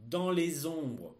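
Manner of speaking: slow, loud dictation; dictating. Shown as a demonstration. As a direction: read it out for pupils to write. Teacher dictating a text.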